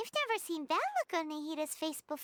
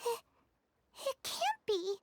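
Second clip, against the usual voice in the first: higher, stunned; a stunned higher gasp of words; shocked disbelief; 7 semitones higher than usual. Acted. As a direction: catch your breath in disbelief. Shocked realization about the plant.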